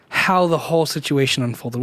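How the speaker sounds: ordinary talk, nothing marked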